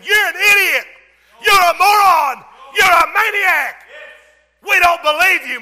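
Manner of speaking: loud, high-pitched shouted insults; mocking ridicule. Scoffer jeers at the man of God, mocking shout. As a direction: hurl each insult in a high, preaching shout. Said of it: "He's laughing at the man of God".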